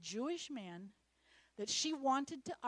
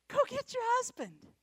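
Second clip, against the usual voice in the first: high, loud go get your husband; Jesus' command; 12 semitones higher, 7 dB louder than usual. Acted. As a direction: deliver it as a firm call. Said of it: "Jesus says".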